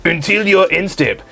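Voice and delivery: mock French accent. Reading a barking forfeit to friends, mock French accent. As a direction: read the rule as a comic Frenchman. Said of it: "Still French".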